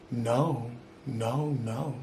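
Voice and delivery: soft, fading repeated no; quiet dazed. Retold or imitated. Imitating friend's dazed refusal.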